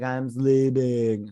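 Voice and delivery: very slow, drawn-out whine; mock sleepy. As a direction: drag the words out in hammed-up drowsy protest. Acts out woman woken by knock.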